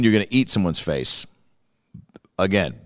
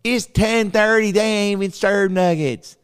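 put-on kid voice; 11 semitones higher than usual. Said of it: "the kid goes".

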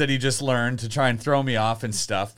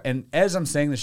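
ordinary delivery

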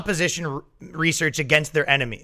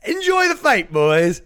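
high, sing-song gloating; mock taunt; 4 semitones higher, 3 dB louder, slower than usual. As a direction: egg them on with a smug high lilt. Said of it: "and you're like".

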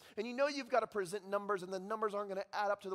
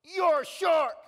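much higher and louder, gloating yell; triumphant kid shout; 10 semitones higher, 11 dB louder, slower than usual. Retold or imitated. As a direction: crow it out like a kid who just won. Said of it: "he jumps up and he goes".

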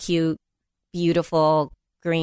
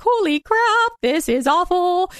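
shocked; 10 semitones higher than usual. Retold or imitated. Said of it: "I was like".

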